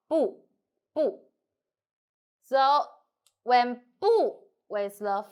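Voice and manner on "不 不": 不 high, then falling sharply; original falling tone. Shown as a demonstration. Teacher repeats 不 for learners, keeping its original falling tone. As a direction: say 不 short with a steep fall. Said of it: "Keep first tone".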